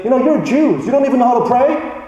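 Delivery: half-hearted imitation of a scold; mock scolding. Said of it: "Jesus doesn't scold them and say".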